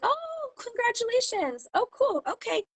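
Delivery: high, drawn-out mock politeness; token congratulations. People seeing a website post once, imitated with high, drawn-out politeness.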